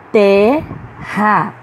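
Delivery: "Terra" split in two, strong first syllable; stressed syllable demo. Shown as a demonstration. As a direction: say "Terra" as Ter... ra, leaning on Ter. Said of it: "há uma sílaba pronunciada com mais força". ("Terra" silabado, com força em Ter)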